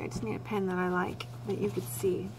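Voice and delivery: quiet, absent-minded aside; trailing under-the-breath chatter while hunting for a pen; muttering to self. Real, not acted. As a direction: talk softly to yourself while searching.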